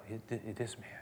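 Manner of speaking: a judging whisper under the breath; host whispering his judgment to himself; inner whisper. Retold or imitated. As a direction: hiss it privately, disapproving. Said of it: "he says to himself".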